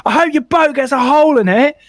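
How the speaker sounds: high-pitched mimicry of an irate woman; imitated bread lady. Bread lady curses the boater's boat, imitated high voice. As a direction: put on her high voice for a curse. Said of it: "she just goes".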